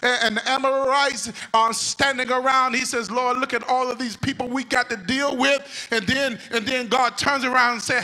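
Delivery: loud, high whooping preacher cadence; full-force preaching. Acted. Preacher to congregation, loud whooping cadence.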